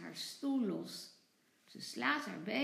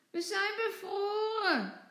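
put-on higher, louder childish wail; 9 semitones higher, 6 dB louder than usual. A narrator's reading. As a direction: whimper like frozen children. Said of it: "jammeren ze". (jammerend huilerig)

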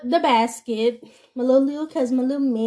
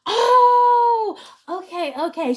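hammed-up embarrassed realization; mock stranger's surprise; 9 semitones higher, much slower than usual. Stranger realizes her mistake.

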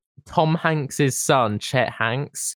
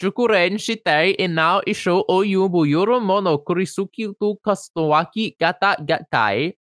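mock Japanese reading; 5 semitones higher than usual. Reading the proverb to his friend in mock Japanese. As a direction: ham up a pretend Japanese reading. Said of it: "I'm gonna read it in Japanese for you".

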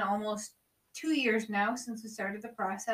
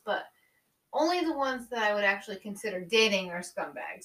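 usual speaking voice

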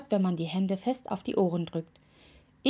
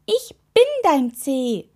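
high, loud, drawn-out protest; indignant call; 6 semitones higher, 7 dB louder, slower than usual. A narrator's reading. Toe insisting to the witch who he is, indignant call. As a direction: spell it out slowly in indignation. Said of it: "rief der Rausguckzeh".

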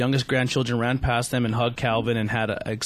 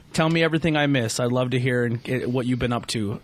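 plain reading voice

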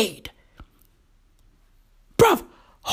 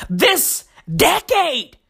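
usual speaking voice

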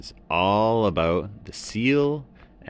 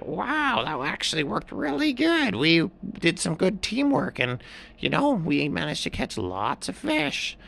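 high heron voice; a pleased bird, pitched up; blue heron; 11 semitones higher than usual. A narrator's reading. Heron praising the teamwork, high voice. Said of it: "and the blue heron said".

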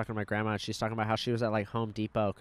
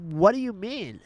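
higher-pitched retold disbelief; incredulous; 7 semitones higher, 4 dB louder than usual. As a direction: act out baffled protest at a grandpa. Retells own disbelief at grandpa.

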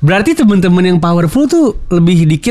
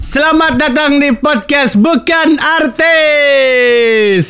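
announcer shout; 11 semitones higher, much slower than usual. Acted. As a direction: boom out the show intro slowly. Podcast intro welcome announcement.

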